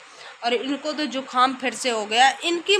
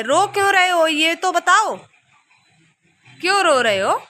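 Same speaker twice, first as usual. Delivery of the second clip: loud coaxing question; 4 semitones higher, 5 dB louder than usual. Real, not acted. Mother asking her crying son why, loudly. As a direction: call out to a crying little one.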